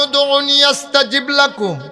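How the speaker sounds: high, loud ringing promise; impassioned sermon cry; full-force preaching. Acted. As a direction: call out to the faithful with fervor.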